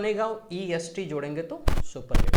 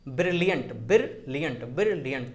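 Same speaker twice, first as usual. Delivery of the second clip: "Brilliant" split as ब्रिल-लियंट each time; pause inside word. Shown as a demonstration. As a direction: repeat "brilliant" with a tiny gap mid-word. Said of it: "यहाँ पर भी आपको pause मिल रहा है".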